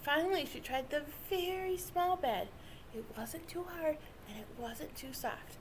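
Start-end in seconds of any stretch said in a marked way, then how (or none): none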